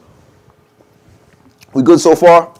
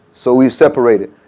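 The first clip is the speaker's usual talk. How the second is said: ordinary talk, nothing marked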